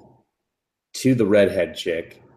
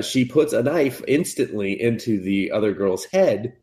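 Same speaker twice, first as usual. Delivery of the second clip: ordinary talk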